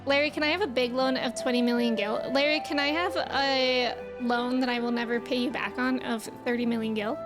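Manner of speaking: ordinary talk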